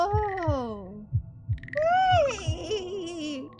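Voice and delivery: high, strained sarcastic cry; disgusted shock. Real, not acted. Player seeing a horror reveal, appalled. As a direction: recoil then mock cheer.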